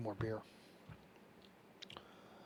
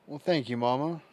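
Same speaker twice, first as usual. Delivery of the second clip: raised voice to someone across the room; calling out to mom; 3 semitones higher, 8 dB louder than usual. Real, not acted. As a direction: call it out loud to your mother in another room. Calling out to mother off-mic.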